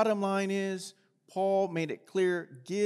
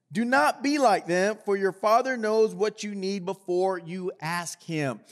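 raised, projecting scripture read to the congregation; 4 semitones higher, 5 dB louder than usual. Acted. Pastor reads Jesus' words on prayer to the church, projecting. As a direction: read the Gospel passage out with weight.

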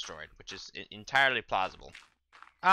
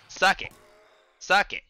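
gloating jeer; 8 semitones higher, 8 dB louder than usual. Real, not acted. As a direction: jeer it higher and louder. Gloating after a kill.

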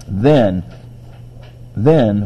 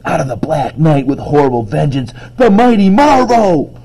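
comic-book proclamation; 4 semitones higher, 5 dB louder than usual. Acted. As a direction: declaim it like a superhero's grand entrance. Child quoting comic book heroics.

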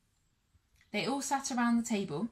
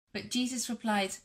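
plain reading voice

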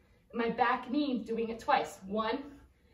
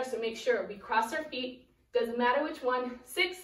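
ordinary talk, nothing marked

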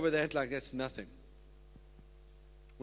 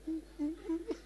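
high giggle; 13 semitones higher, 6 dB quieter than usual. Real, not acted. Laughs at own joke.

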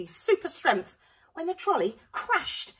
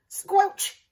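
higher, loud sound word; comic squelch; 13 semitones higher, 9 dB louder than usual. A narrator's reading. Narrator voicing the squelch of jelly. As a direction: make it sound squishy.

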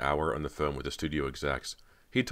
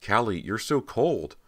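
higher, slower, shocked cry; startled surprise; 7 semitones higher, 5 dB louder, slower than usual. Acted. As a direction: pull back, startled by the cold. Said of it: "unable to hide her surprise".

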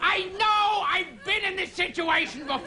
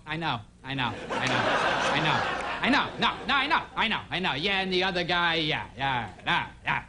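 mumbling Nostradamus; 11 semitones lower than usual. Retold or imitated. Nostradamus voiced as bored.

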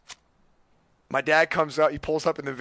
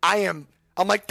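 ordinary talk

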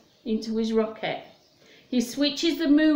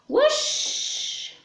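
a sudden high breathy whoosh of a flying saucer; high airy whoosh. Acted. Teacher making the saucer fly away for the kids. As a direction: whoosh it up like a rocket taking off. Rocket sound in song.

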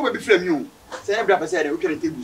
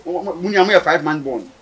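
usual speaking voice

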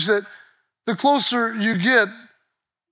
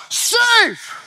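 umpire call; 10 semitones higher than usual. Retold or imitated.

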